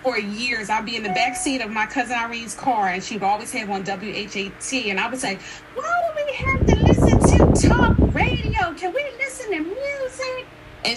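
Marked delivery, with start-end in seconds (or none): 5.8-10.5 put-on high-pitched pleading child's whine; mock childish protest, pitched way up; whiny kid complaint. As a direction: whine it like a bored kid in the back seat. Child complaining to older cousin, retold.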